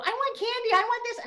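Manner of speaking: put-on high, whining child begging for candy; whiny kid. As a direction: mimic a kid whining at the checkout. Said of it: "and they would whine".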